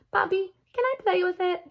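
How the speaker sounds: put-on high, eager child's plea; pleading little girl. A narrator's reading. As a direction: ask Dad sweetly. Girl asks father.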